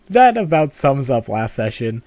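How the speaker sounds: ordinary delivery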